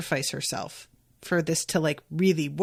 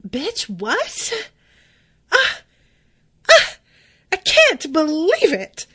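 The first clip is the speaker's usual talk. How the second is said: much higher, louder gasping indignation; hammed-up scandalised gasp; offended housewife; 8 semitones higher, 5 dB louder than usual. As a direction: play an insulted Orange County housewife clutching her chest. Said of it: "Orange County housewife who's just been insulted".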